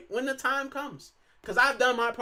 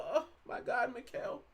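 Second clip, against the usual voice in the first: softer, very slow hammed-up heartbreak with a sigh; mock crying; 4 semitones higher, 9 dB quieter, slower than usual. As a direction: fake a heartbroken fan, sighing. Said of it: "I'm not gonna cry and go".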